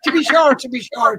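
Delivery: hammed-up Irish accent, loud and high at first; mock Irish accent. Greeting Karen by teasing her with a hammed-up Irish accent. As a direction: play-act a stage Irish lilt to tease a friend. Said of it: "tease Jimmy Cricket about his bloody accent".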